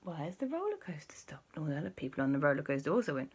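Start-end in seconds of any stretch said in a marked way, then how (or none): 0.0-1.6 hushed, uneasy question; puzzled whisper. Acted. Stranded riders ask each other, puzzled whisper. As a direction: whisper it into the silence. Riders wonder why it stopped.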